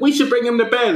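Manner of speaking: high-pitched parent imitation; mock doting parent. Parent on the sleeping son, high mock concern. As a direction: pitch it up like a fussing mother. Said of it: "they're like".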